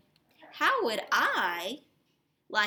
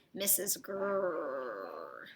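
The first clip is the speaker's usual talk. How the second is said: low, very slow growl on the name; 7 semitones lower, 6 dB quieter, much slower than usual. Acted. Teacher growling the mean neighbor's name in a low slow voice. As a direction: growl it like a grumpy beast.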